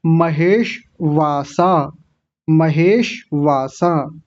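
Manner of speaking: महेश वासा slowly, sh held as a half sound before vaa-saa; careful pronunciation. Shown as a demonstration. Teacher shows how to say it, slow महेश वासा. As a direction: split महेश वासा into slow, full syllables. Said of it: "कैसे बोलेंगे".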